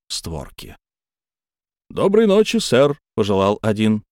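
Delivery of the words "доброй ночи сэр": high, courteous servant greeting; bow and wish him good night; polite footman. Acted.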